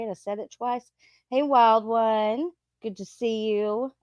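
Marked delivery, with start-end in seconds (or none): none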